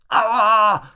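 high, loud cry of "ow"; loud yelp of pain; pained yell. A narrator's reading. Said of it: "schrie er nach unten".

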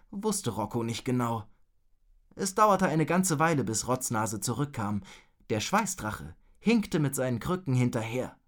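plain reading voice

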